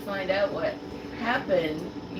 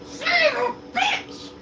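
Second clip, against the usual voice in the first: furious yell. Retold or imitated. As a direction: scream the curse in rage. Goblin swears after crashing.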